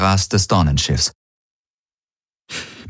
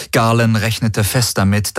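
plain reading voice

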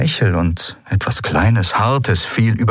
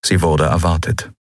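ordinary delivery, nothing marked

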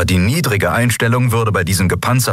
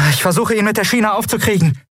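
shouting; 13 semitones higher than usual. Acted. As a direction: yell it over the noise of battle. Shouted over the fight.

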